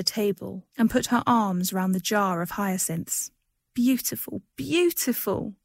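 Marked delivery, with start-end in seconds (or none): 3.7-4.4 bright, lifted cry of delight. A narrator's reading. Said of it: "she cried". 4.6-5.6 drawn-out high, slow beautiful; rapturous cry. A narrator's reading. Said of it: "she cried".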